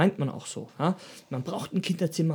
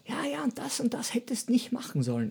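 whispered mock nagging, pitched higher; father's nagging; 6 semitones higher, 8 dB quieter than usual. Son repeats his dad's constant criticism, whispered mock nag. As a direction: hiss it like a father's quiet reproach.

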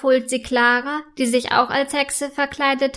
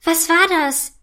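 higher-pitched, mildly frightened question; startled, raised voice; 5 semitones higher than usual. A narrator's reading. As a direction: ask with a touch of fright. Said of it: "fragt Clara erschrocken".

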